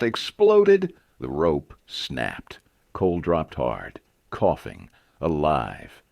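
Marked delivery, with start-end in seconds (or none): none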